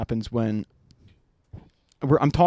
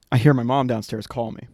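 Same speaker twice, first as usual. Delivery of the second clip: usual speaking voice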